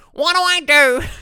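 high, loud helpless whine; hammed-up panicked plea for help; mock helpless client. Coach mocking clients asking him for help, high helpless whine. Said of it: "That's my impression of you guys".

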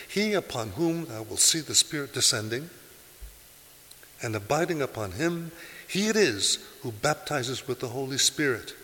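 loud, high preached reading; preaching at full force. Acted. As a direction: proclaim the testimony to the congregation. Reading John's testimony with force.

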